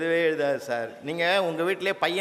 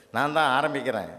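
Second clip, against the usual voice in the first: normal talking voice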